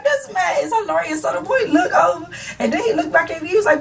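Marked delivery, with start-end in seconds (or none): 0.0-1.2 laughing through the words, pitched up; real laughter, higher pitch. Real, not acted. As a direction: say it while cracking up. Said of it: "So I'm cracking up".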